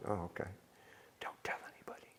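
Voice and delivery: a breathless whisper, barely voiced; whispered secret. Answering the captain in church, whispered. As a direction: whisper it back like a co-conspirator.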